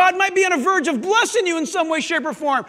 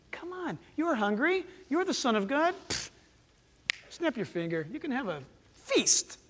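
soft coaxing tempter; 7 dB quieter than usual. Acted. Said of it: "Do you hear what he's saying to him?".

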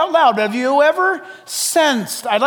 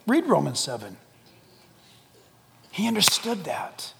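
usual speaking voice

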